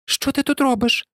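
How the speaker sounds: high-pitched small creature voice; squeaky little chick. A narrator's reading. Chick questions the unexpected visitor, squeaky and high.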